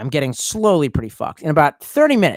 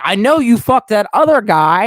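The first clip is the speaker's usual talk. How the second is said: retold accusation; 6 semitones higher, 7 dB louder than usual. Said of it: "I was like".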